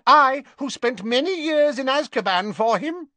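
high, fierce fanatical cry; proud zealous witch; passionate. Acted. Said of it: "said Bellatrix passionately".

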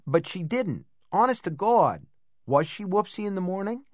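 disbelieving big brother; surprised brother. A narrator's reading. Frank voiced, surprised question.

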